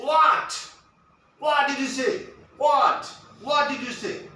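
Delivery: loud, high, rude shout of what. Shown as a demonstration. Candidate rudely shouting at the examiner. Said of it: "You don't have to speak like this".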